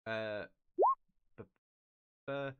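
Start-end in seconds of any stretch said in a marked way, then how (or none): none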